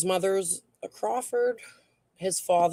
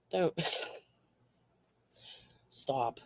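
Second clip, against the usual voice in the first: giggling through the words; barely holding back laughter; laughing; 4 semitones lower, 9 dB quieter than usual. Real, not acted. Reading a chat joke about a bird with tits, laughing.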